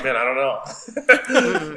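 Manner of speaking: slow, laughing through a retold thought; laughing retold quote. Guest retelling his hesitation to cuss, slow laughing. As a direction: chuckle the words out slowly. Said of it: "but I was like".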